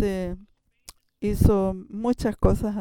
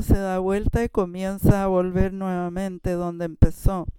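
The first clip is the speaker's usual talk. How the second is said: usual speaking voice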